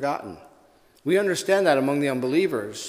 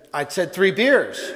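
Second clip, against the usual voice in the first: louder, higher, slow insistence; upset; 4 semitones higher, 5 dB louder, slower than usual. Acted. Irishman repeating his order to the bartender, mock upset. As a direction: lean on it like he's been shortchanged. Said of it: "The Irishman gets upset".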